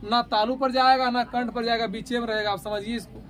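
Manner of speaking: normal talking voice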